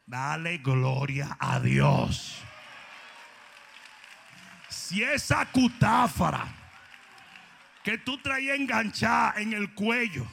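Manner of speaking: loud, rising sermon shout over music; fiery preaching. Acted. Preacher shouting hope to the heartbroken, fiery. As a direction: preach it out to the congregation.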